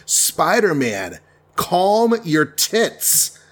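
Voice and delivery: very slow, stretched-out telling-off; drawn-out admonishing. Retold or imitated. The Avengers rein in a fighting Spider-Man, slow admonishing. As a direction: spell it out slowly, rising in pitch.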